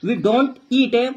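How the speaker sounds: very slow, each word spelled out as it is written; slow dictation. Shown as a demonstration. Teacher dictating a sentence to students, very slow. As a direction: read it out slowly, like dictation to a class. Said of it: "यहाँ पर ईट हम बिल्कुल simple लिख देंगे".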